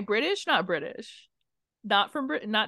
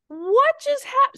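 stunned disbelief; 6 dB louder, slower than usual. Retold or imitated. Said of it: "And you're like".

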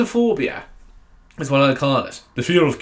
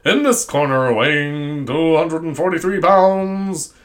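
drawn-out booming ring announcer; milk the name like at a title fight; boxing ring announcer; 5 dB louder, much slower than usual. Pretend. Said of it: "boxing match".

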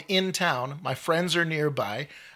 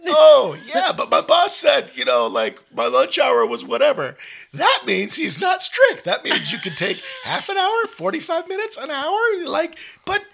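man doing a woman's voice; 14 semitones higher, 5 dB louder than usual. Retold or imitated. Mom scolding, retold.

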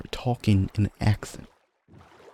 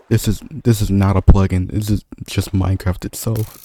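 louder emphatic correction; insistent; 6 dB louder than usual. Real, not acted. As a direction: stress that it's no plugin. Insisting to friend in game.

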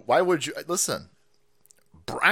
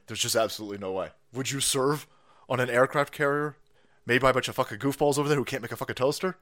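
ordinary talk, nothing marked

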